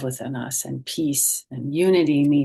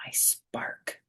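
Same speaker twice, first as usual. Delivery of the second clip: whispered; 11 dB quieter than usual. A narrator's reading. Hushed reading of the book.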